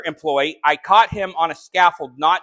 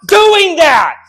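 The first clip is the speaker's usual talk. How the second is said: slow, shouted commands, much higher; in-your-face rebuke; 11 semitones higher, 9 dB louder, slower than usual. Acted. Preacher rebuking church members to their face, shouting. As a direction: bark each order right in their face. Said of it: "get in your face and say".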